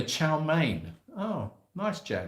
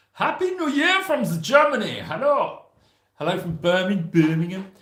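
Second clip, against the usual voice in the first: mock German accent; 7 semitones higher, 5 dB louder than usual. Greeting German viewers playfully.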